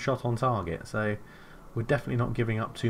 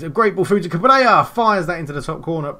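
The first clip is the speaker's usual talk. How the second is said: rising, louder goal call; thrilled play-by-play shout; excited commentary; 6 semitones higher, 9 dB louder than usual. Real, not acted.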